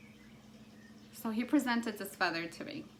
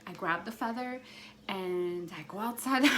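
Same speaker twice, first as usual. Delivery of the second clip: ordinary talk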